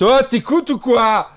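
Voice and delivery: slow, loud, high teacher outburst; sudden angry teacher. Pretend. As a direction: snap at the class out of nowhere. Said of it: "alors que ça fait dix minutes que le prof parlait normalement". (prof qui explose soudain)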